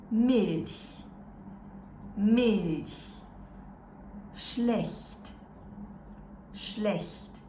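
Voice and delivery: parole tedesche scandite lentamente da ripetere; model German words. Shown as a demonstration. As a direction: pronuncia chiara ch morbido, a modello. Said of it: "Try and pronounce after me".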